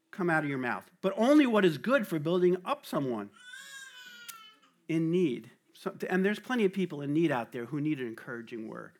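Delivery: normal talking voice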